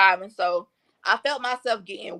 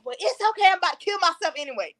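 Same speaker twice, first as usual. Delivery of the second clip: much higher, quick and careless, brushing it off; high flippant reply; 9 semitones higher, faster than usual. Retold or imitated. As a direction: toss it off high and fast, like nothing matters. Retold reply to boyfriend on phone.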